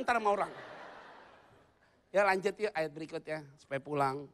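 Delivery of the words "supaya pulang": ordinary talk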